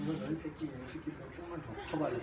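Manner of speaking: soft, half-joking grumbled warning; mock muttered threat. Father to his toddler, a soft mock threat. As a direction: mutter a mock threat under your breath. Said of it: "내가 계속 벼르고 있어".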